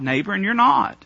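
ordinary talk, nothing marked

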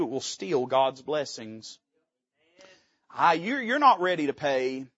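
ordinary talk, nothing marked

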